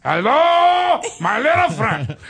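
high, loud mock Miami accent; over-the-top Miami movie-villain greeting. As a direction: belt it out in a hammed-up Miami gangster accent. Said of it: "Well, Miami, they don't even speak English".

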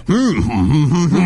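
lightly voiced French chef; hint of a French chef. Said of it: "You're doing like a French chef".

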